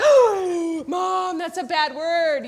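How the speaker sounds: loud, high mock-scandalized kid; shocked child. Child catching mom saying shut up, loudly mock-shocked. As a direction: gasp and scold like an appalled child.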